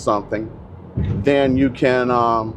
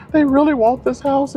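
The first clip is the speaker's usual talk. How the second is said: even higher whiny plea; mock crying agent. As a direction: push the pitch further up, pleading. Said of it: "literally crying".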